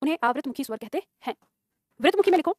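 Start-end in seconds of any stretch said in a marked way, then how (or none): 0.0-1.3 dictation. Acted. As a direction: dictate it phrase by phrase, repeating evenly. Teacher dictates a definition. (रुक-रुककर लिखवाते हुए)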